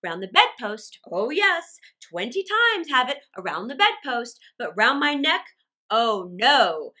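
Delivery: loud, emphatic sarcasm; heavy mocking stress, easing off at the end; dangerously sarcastic. A narrator's reading. Father rants about his tie, loud biting sarcasm. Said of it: "He became dangerously sarcastic".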